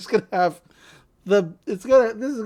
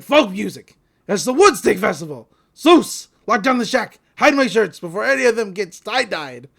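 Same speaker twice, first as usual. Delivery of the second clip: loud, hammed-up alarm; retelling a cartoon scene with loud mock alarm; 7 dB louder than usual. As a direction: bark the orders like a grumpy shopkeeper under siege. Retold cartoon alarm at hippies.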